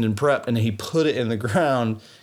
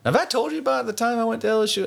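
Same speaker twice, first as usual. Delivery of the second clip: normal talking voice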